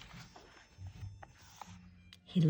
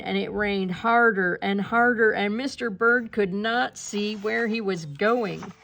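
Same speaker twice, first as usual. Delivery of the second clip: plain reading voice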